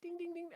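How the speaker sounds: high-pitched dinging noise; ping it high like an alert; imitated alert bell. Retelling Wisconsin results popping up, high alert dings.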